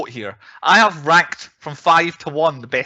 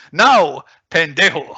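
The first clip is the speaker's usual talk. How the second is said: Sean Connery quote; 2 semitones higher, 6 dB louder, slower than usual. Retold or imitated. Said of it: "I've also got Sean Connery going".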